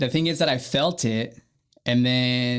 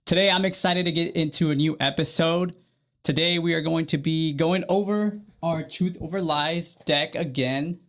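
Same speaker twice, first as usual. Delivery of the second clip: podcast intro; 5 semitones higher than usual. Acted. Host welcoming listeners to the Living Gratitude Podcast, upbeat announcer.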